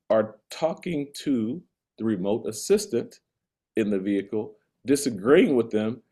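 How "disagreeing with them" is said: escalating comedy rant. Real, not acted. Escalating rant about Waymo riders.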